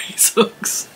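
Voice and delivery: "these hooks" breathed out voiceless, laughing; whispered through a laugh. Real, not acted. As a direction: let it slip out as a giggling whisper. Laughing, breathy final repeat.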